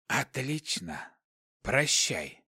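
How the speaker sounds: quiet, slow, emotionless hiss. A narrator's reading. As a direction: hiss it slowly and softly. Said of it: "Шипящий голос не выражал никаких эмоций".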